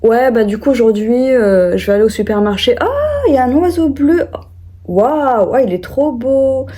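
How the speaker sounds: a lightly voiced imitation of a rambling voice memo; mock rambling voice message. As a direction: play someone distracted mid-message. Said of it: "c'est des audios du genre".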